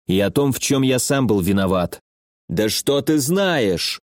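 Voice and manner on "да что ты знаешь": ordinary delivery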